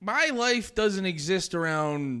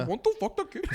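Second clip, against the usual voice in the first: put-on Indian accent; 8 semitones higher, faster than usual. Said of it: "when you do it in an Indian accent".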